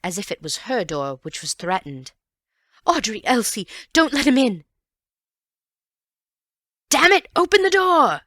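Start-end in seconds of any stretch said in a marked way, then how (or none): 2.9-4.6 loud high frightened plea; panicked plea. A narrator's reading. Panic continues. 6.9-8.2 loud, high raging yell; angry man shouting at the locked door; furious shout. A narrator's reading. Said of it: "came the voice again".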